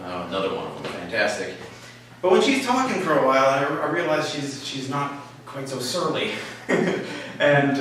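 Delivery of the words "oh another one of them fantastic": sarcastic. Retold or imitated. Sarcastic thought about newcomer.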